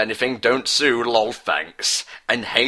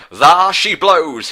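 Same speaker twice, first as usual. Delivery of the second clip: much higher, louder, slow shout; cried out; 7 semitones higher, 8 dB louder, slower than usual. Acted. Hunter spotting the monster's tail, shouting loud and slow. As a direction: bellow it like a whaler spotting prey. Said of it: "he cried".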